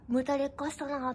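sneering whisper of an insult; whispered mean comment from a viewer; retold whispered taunt. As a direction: hiss it out mockingly under your breath. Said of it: "とか言って".

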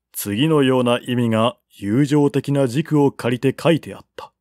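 ordinary delivery

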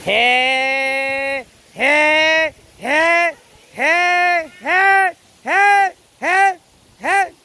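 risa que sube de tono con cada je; risa ascendente. Real, not acted. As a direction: carcajada que escala hacia agudo. (risa que va subiendo)

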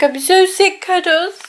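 ordinary talk

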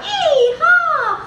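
high, drawn-out yee-haw; high-pitched storytime cowboy holler; cowboy yell. A narrator's reading. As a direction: whoop it like a cowboy in a parade. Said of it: "Cowboys on horses yell out loud".

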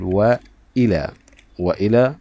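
"وإلى" as wa-ila with a clear glottal stop; hamza pronounced. Shown as a demonstration. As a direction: break between wa and ila in "وإلى". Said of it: "الهمزة يا أبنائي ظهرت معي". (نطق «وإلى» بهمزة ظاهرة)

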